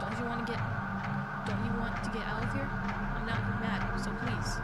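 loud, menacing, unhinged shouting; crazed yelling. Acted. Crazed Rin shouts at her through the locked door. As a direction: bang on the door and yell.

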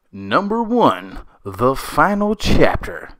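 slow, loud booming announcer. Acted. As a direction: boom it out like a movie trailer. Announcing top countdown entry.

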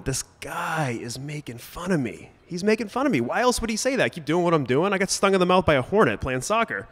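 high-pitched youthful outrage; acted-out indignation at being made fun of; indignant. Retelling his indignant younger reaction.

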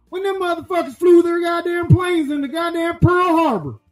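heated shout, pushing up; angry rant shout. Acted. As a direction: yell it as the rant peaks. Ranting climax about Pearl Harbor.

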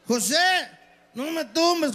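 put-on high-pitched pleading drunk; borracho tambaleante. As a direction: call out high like a staggering drunk. Borracho habla solo por la calle. (borracho suplicando en voz aguda)

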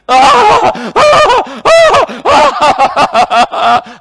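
high, hammed-up wailing sobs; exaggerated wailing cry, very loud; loud mock weeping. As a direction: imitate a man bawling in loud, high bursts. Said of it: "he was weeping".

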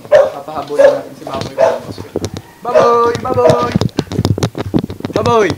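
loud high calls across the yard; calling out. Real, not acted. Owner calling pig to chase, loud high calls. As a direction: shout the pig's name to get it running.

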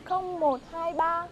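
slow, careful reading of phone digits; slow dictation. Shown as a demonstration. Reading her number slowly to the troupe leader, slow dictation. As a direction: spell each digit out slowly. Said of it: "Đọc rồi đi".

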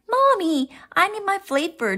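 put-on high, quick child's plea; pleading child. A narrator's reading. Froggy asking mother for flippers, high quick plea. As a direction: pipe up urgently, pitched high.

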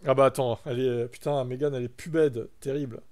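normal talking voice

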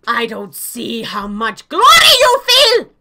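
loud mock-patriotic demand; shouted glory. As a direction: yell it like a zealous official. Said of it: "We're supposed to shout it".

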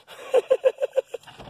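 big high laugh; high burst of laughter. Real, not acted. Dad cracking up at his son, a high burst of laughter.

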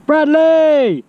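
loud high call across the room. Real, not acted. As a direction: call his name out brightly. Calling out to brother on arrival.

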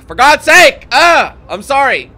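loud, high frustrated yell; exasperated outburst. Real, not acted. Streamer snapping at backseat chat, loud and fed up. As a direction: blow up at the chat, then catch yourself.

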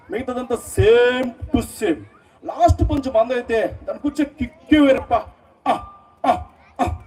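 film-hero swagger with punctuating grunts; Pawan Kalyan. Acted. Mimic doing Pawan Kalyan's movie dialogue for the audience, swaggering delivery. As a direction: toss out punchlines in broken phrases, stretching the key words. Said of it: "అదే cinema dialogue చెప్పినప్పుడు ఎలా ఉంటది".

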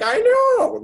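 a jokey high-pitched squeal at the crows; squeaky high mock voice. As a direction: squeal it like a cartoon critter. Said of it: "I'm like".

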